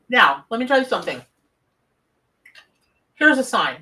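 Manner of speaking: talking round ice in mouth; mouth full. Real, not acted. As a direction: speak with ice in your mouth. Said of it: "I got ice in my mouth".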